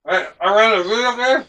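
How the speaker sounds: talking through a mouthful of food; eating while speaking; mouth full. Real, not acted. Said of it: "don't talk with your mouth full".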